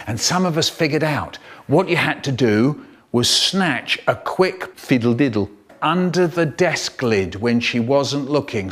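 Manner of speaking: ordinary delivery, nothing marked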